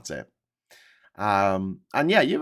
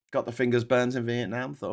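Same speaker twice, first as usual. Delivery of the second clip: ordinary talk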